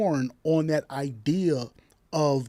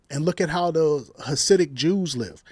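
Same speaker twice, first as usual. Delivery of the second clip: ordinary talk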